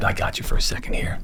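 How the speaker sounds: fast unvoiced whisper, confiding. Cornering [name] to thank him, hushed whisper. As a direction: lean in and whisper it quickly.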